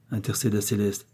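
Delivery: plain reading voice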